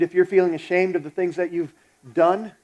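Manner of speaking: ordinary talk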